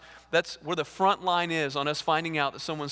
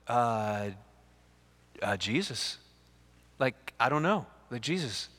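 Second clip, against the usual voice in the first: low, soft, halting mumble; acted unsure reply; 6 semitones lower, 4 dB quieter than usual. Criminal fumbles his answer to the guy in heaven, unsure. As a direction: answer hesitantly, as if clueless.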